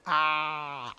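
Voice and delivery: ordinary talk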